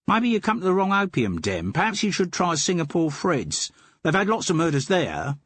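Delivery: the den keeper dropping the act for his own Cockney; Cockney twang. Acted. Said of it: "in a voice with a Cockney twang".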